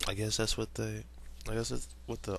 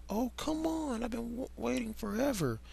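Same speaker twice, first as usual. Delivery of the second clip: put-on whiny kid voice; 12 semitones higher, 3 dB quieter than usual. Steven whines, high character voice.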